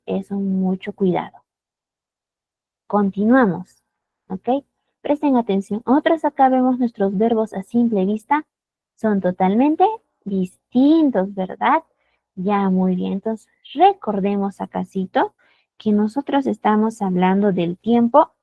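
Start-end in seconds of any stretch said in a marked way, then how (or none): none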